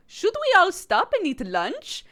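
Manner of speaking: high, slow mock French teacher accent, overdone and wrong; botched French accent for the gazelle, then admits it; wrong Madame Gazelle accent. Streamer botches the gazelle's French accent, high and slow. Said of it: "that was not her accent at all".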